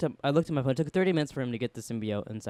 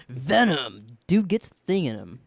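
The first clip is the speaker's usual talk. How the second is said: movie-poster tagline; 5 dB louder, slower than usual. Pretend. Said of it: "put that on a poster".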